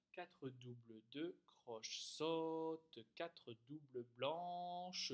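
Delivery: rhythm names spoken slowly in time. Shown as a demonstration. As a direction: drag each note name to its length. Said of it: "Vous le faites comme ça".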